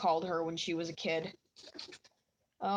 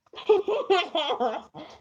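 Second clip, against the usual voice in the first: loud high laugh; 9 semitones higher, 6 dB louder than usual. Real, not acted. Laughing fit continues.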